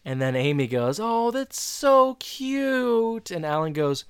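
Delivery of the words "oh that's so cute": very high, drawn-out gush; imitated cooing. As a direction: coo slowly as if over a small child. Amy cooing over little Morgan.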